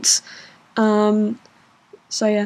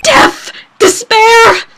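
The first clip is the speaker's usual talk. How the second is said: very high, loud shrieks of doom; hysterical, hammed-up wailing; despairing scream; 14 semitones higher, 7 dB louder, slower than usual. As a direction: scream each outburst like a tragic ham. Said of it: "screaming over there".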